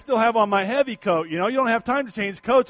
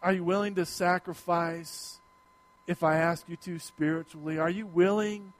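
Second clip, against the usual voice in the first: God's gentle call; 4 semitones lower, 8 dB quieter than usual. Acted. God quietly asking the pastor to sacrifice, gentle. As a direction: ask it quietly, searching the heart.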